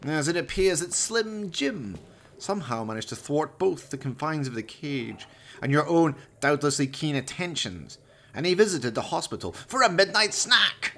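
a villain dismissing his dead henchman; Valdemar, cold villain. Acted. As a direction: savour each cruel word. Valdemar voiced in the story.

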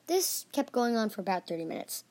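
usual speaking voice

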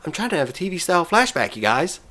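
lightly voiced bratty kid Cartman; a hint of whiny cartoon kid; Cartman impression. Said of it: "my very terrible Cartman impression".

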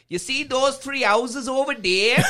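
high, slow, singsong mock Welsh; Welsh accent. Irishman mocking his rugby rivals, high slow Welsh accent. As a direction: stretch it out in hammed-up Welsh. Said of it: "in a Welsh accent".

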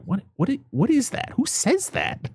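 rising, high-pitched disbelief; baffled reaction. Acted. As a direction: stammer into incredulous questioning. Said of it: "And you're like".

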